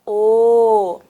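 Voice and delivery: "O" held long; drawn-out vowel "O"; letter sound O. Shown as a demonstration. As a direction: sustain a rounded "O" for learners. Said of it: "juntando com a letra".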